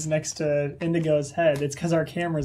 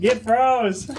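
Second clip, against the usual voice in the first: higher, louder gloating taunt; 4 semitones higher, 6 dB louder than usual. Acted. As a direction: rub it in, gloating.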